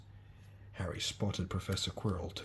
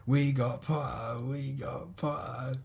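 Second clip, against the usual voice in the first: slow, higher triumphant chant; twins yell; 5 semitones higher, slower than usual. A narrator's reading. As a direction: crow it out to the whole table. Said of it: "the Weasley twins yelled".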